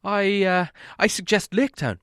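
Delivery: a timid hobbit offering an idea; Bilbo's voice. A narrator's reading. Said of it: "said Bilbo".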